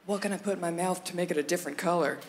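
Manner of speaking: a hint of the other kind of kid; lightly voiced candy kid. Comic voicing the candy kid at recess, lightly put-on low voice. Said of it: "kids who are like".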